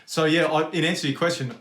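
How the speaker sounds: ordinary talk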